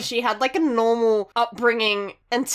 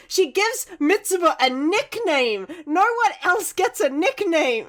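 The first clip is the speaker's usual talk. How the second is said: higher, slow, emphatic indignation; mock-outraged fan rant; 7 semitones higher, slower than usual. Real, not acted. Fan arguing two characters should be a couple, playfully angry. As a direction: argue the case slowly in a high, exasperated voice. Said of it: "what makes me angry".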